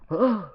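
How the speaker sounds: high, sharp cry of pain; stabbed cry. Retold or imitated. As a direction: yelp like you've been stabbed. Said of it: "you just see him go like".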